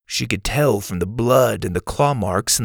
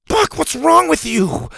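much higher voice, lightly scolding; 4 dB louder than usual. A narrator's reading. Bo scolding his T-Rex, light scolding. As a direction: tell off the pet dinosaur. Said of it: "Bo scolded".

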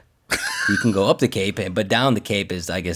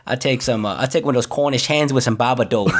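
laughing Boston order; 3 semitones higher than usual. Acted. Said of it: "mock Boston order".

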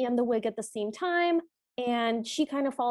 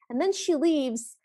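ordinary talk

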